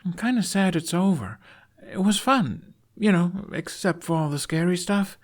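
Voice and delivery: put-on a young boy sad the adventure ends; young boy's voice. A narrator's reading.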